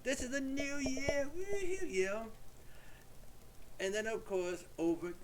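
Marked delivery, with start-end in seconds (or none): 0.0-1.9 high, slow mock proclamation ending in a squeal; mock announcement. Paraders showing off the new-year baby, high and slow with a squealed woohoo. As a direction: announce the baby like a silly herald. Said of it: "You know, like".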